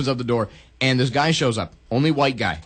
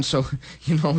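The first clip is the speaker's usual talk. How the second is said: ordinary talk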